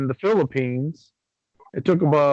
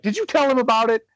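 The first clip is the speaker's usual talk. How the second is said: high, accusing question; stepdad's suspicion; 8 semitones higher, faster than usual. Retold or imitated. Stepdad asking wife if she told him. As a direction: turn on the wife, half-mock suspicious. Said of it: "he looks at my wife".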